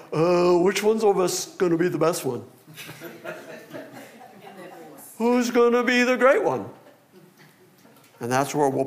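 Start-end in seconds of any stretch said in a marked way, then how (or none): 0.1-6.7 lightly voiced dim-witted disciples, with a laugh; a hint of a sheepish squabbling disciple; apostles impression. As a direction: play the clueless apostles, half-giggling. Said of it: "That was my impression of the apostles collectively".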